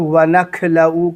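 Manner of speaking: وَنَخْلَعُ imitated with a k sound; mispronounced kha. Teacher quotes what many people say, وَنَخْلَعُ with k. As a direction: mimic the error, kaf for خ in وَنَخْلَعُ. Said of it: "جیسے لگ رہا ہو کاف کی آواز".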